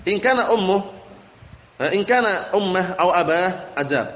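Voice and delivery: slow measured recitation of the Arabic; slow Arabic text reading. Shown as a demonstration. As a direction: read the kitab line deliberately. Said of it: "reading Arabic text aloud".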